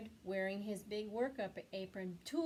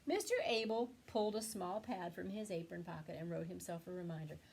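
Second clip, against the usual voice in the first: usual speaking voice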